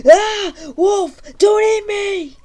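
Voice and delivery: high, loud panicked shriek at a wolf; very loud scream. Acted. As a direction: scream it out, terrified the wolf will bite. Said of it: "says very loud".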